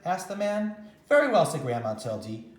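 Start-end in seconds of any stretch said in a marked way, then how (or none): 1.1-1.4 high, loud eager agreement; bright exclaim. Acted. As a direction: sing out a cheerful yes.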